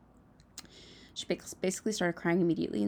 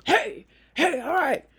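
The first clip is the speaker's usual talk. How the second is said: higher, louder host voice breaking up a fight; Chris Harrison calming the room; 8 semitones higher, 7 dB louder, slower than usual. Retold or imitated. Host imitates the show host settling the yelling cast, loud mock call. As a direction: play the host cutting in over shouting men. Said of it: "Chris Harrison would have to be like".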